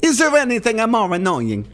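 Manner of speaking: hammed-up Italian accent. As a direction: say it like a cartoon Italian. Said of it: "I sounded Italian there".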